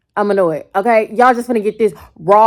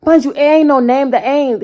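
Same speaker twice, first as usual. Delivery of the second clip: usual speaking voice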